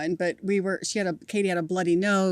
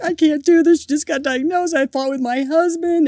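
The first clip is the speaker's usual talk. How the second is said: high, loud, tearful wail, retold; a loud high sob-talk remembered; retold sobbing; 9 semitones higher, 8 dB louder than usual. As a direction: replay the breakdown, voice cracking high. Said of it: "and I'm sobbing".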